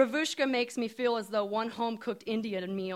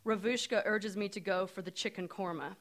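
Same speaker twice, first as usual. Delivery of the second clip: plain reading voice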